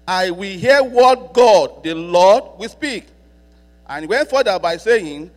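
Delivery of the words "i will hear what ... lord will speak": very slow and loud, stretching each word; emphatic proclamation. Shown as a demonstration. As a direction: proclaim it again even harder, leaning on hear. Repeats verse with more force.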